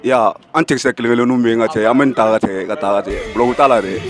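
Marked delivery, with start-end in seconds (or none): none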